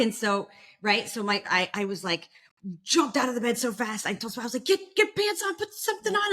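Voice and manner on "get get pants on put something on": high, hurried urging to get moving; urgent rush. Retold or imitated. Telling her sister in labor to get dressed, high urgent rush. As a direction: hurry her up, voice way up.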